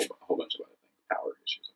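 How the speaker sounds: whispered, quick throwaway list; whispered aside. Real, not acted.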